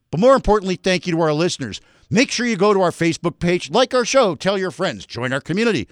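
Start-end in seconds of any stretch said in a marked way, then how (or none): none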